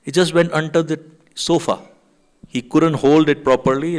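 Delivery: ordinary talk, nothing marked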